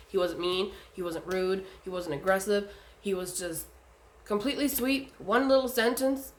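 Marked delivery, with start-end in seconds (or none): none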